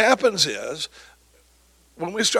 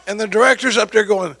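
normal talking voice

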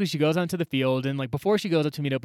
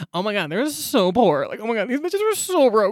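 high, mocking valley-girl sneer; mock the rich girls gawking at poverty; snobby rich girl; 9 semitones higher, 5 dB louder than usual. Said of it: "upper deck bitches ... be like".

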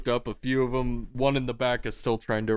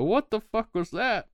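high-pitched startled yelp; startled man; 14 semitones higher than usual. Acted. Startled guard at a sudden voice: high alarmed cry.

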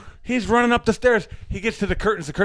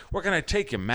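softer, lightly put-on New York cabbie; 7 semitones lower, 7 dB quieter, faster than usual. Cabbie repeats himself, mild New York accent. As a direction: toss it off with a touch of New York. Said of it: "that's how they talk in New York".

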